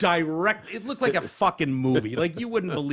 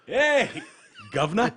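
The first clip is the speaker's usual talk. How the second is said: high-pitched mock hello; awkward greeting; 7 semitones higher than usual. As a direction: greet the boss like you've just been caught. Acting out an awkward greeting to the governor.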